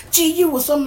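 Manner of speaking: very high, loud mock yell; insistent friend. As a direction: shout the claim in hyped-up disbelief. Friend insisting louder in retold exchange.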